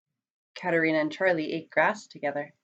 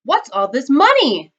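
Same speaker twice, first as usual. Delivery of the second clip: loud, high astonished question; raised-pitch, startled shout; 6 semitones higher, 9 dB louder than usual. A narrator's reading. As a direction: cry it out to the whole crowd. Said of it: "shouted Mister Buswell".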